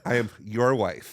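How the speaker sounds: put-on slow, deliberate American accent; careful American, as if teaching the language. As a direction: enunciate it slowly in American. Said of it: "He doesn't speak American".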